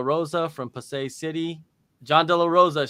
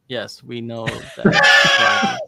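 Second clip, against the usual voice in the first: sarcastic; 3 semitones lower, 8 dB louder, much slower than usual. Real, not acted. Mocking the butt comment.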